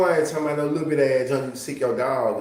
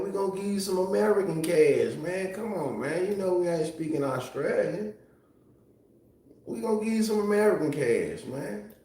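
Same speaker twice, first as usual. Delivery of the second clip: high-pitched mock wheedling; playful pitch-raised sales plea; put-on high voice; 6 semitones higher than usual. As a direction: pitch it well up, playfully coaxing. Mock offer of American money.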